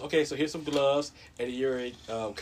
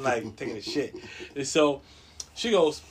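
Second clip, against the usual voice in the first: ordinary delivery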